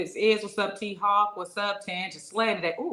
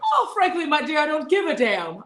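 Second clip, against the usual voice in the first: much higher fifties actress voice; old movie star; 9 semitones higher, 7 dB louder than usual. Acted. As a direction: overact like a classic film star. Quotes the classic film line.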